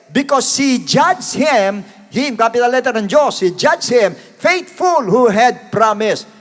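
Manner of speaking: loud, slow, emphatic Bible reading; preacher reading Hebrews to the church; scripture reading. Acted. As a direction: proclaim each phrase.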